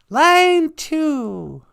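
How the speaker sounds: very high loud sugary voice; high mock cohost voice; sweet cohost voice. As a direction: sing out the line number like a bubbly cohost.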